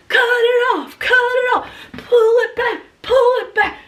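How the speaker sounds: high yells with a laugh; frantic yelling. Retold or imitated. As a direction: shout it across the yard, half laughing. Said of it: "I'm out there going".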